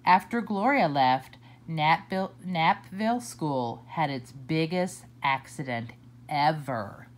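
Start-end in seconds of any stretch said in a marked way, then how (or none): none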